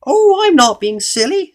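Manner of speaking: very high, loud, slow goblin. A narrator's reading. Goblin contradicting Mister Uppity, high goblin voice. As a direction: protest in a drawn-out squeak. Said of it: "said the goblin".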